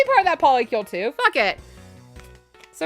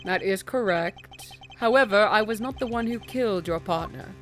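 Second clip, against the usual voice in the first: cool prosecutor voice; 4 semitones lower, 6 dB quieter than usual. Acted. Voicing Edgeworth.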